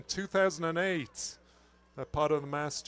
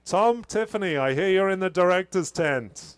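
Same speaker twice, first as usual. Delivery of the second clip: calling out; 4 semitones higher, 6 dB louder than usual. Real, not acted. Calling out to Tom below.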